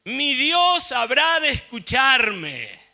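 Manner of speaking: high, loud, drawn-out proclamation; ringing declaration of faith. Retold or imitated. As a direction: raise your voice and declare it slowly. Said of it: "Levanta la voz y agrega".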